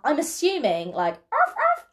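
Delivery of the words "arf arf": high yappy seal bark. Shown as a demonstration. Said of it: "I kinda just wanted to do my seal impression".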